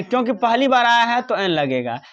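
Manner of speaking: ordinary talk, nothing marked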